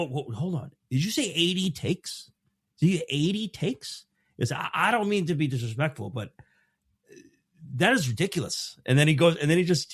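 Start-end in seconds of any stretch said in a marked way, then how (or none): 0.1-4.1 incredulous, pitch jumping up on the questions; startled Robert Duvall. Retold or imitated. Actor cuts into roundtable over the take count, startled. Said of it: "Duvall gets startled". 4.5-8.8 imitated indignant disbelief, higher pitched; mock affronted veteran, voice lifted; startled Robert Duvall. Veteran actor objects to eighty takes, mock indignant. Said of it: "Duvall gets startled".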